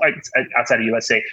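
ordinary talk, nothing marked

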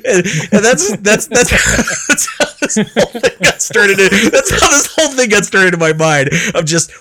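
high, loud, stumbling restarts while cracking up; talking through laughter. Real, not acted. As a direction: try to get the words out laughing. Struggles to resume through laughter.

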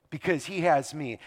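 ordinary delivery

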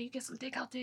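hushed whisper of a scolding mom; a mother's shocked whisper. Retold or imitated. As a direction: say it under your breath, scandalized. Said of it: "She's like".

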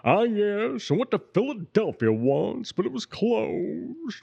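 put-on high nasal old-time drawl; old Hollywood comedian voice; W C Fields. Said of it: "was of W C Fields".

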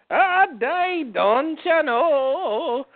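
sing-song mock Irish accent, high on ah dai; a drawn out, slow mock Irish accent. Friend tries a mock Irish accent on request. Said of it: "What about Irish or Scottish?".

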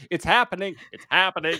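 high, urgent repeat; mock panic. Quoting the bride in the street, high mock panic. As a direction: announce it in dread. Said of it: "her walking".

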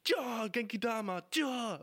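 hammed-up fighting shout; Son Goku. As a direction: launch it like a special attack. Acting Goku's attack shout.

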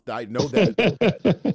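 slow, loud, laughing I know that; laughing recognition. Retold or imitated. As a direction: crow it out while laughing. Fan recognizing show, laughing.

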